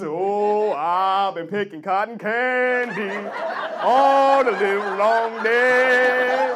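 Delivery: slow, loud drawn-out moan; hammed-up weary worker; mock work-song lament. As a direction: wail it like a field song.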